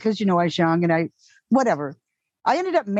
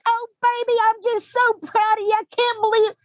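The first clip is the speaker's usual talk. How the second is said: high-pitched, gushing Texas mom; Texas accent; 12 semitones higher than usual. Retold or imitated. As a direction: imitate a proud mother with a Texas twang. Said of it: "you know a Texas accent well".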